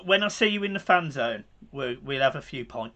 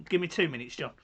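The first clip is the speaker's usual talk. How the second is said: ordinary talk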